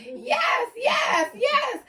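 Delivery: high, loud, drawn-out cheery hello; sing-song party greeting; aunt's cheery greeting. Retold or imitated. Aunt Lisa greets guests cheerfully while hauling him out, high and loud. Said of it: "She was like".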